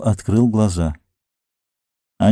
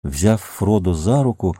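ordinary delivery, nothing marked

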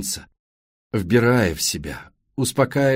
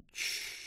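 soft shushing; 20 dB quieter than usual. Acted. Man hushing crying woman.

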